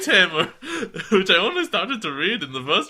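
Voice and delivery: reading aloud through giggles; laughing while reading. Real, not acted. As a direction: keep cracking up as you read.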